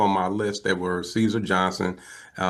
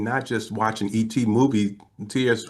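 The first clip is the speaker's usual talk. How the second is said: usual speaking voice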